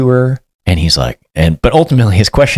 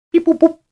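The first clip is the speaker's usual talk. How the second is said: very high beep boop imitation; phone beeps. Employee dialing a phone, high beeping imitation. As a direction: chirp the dialing tones. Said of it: "your employee goes".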